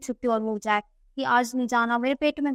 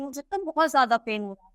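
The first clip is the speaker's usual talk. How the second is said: ordinary delivery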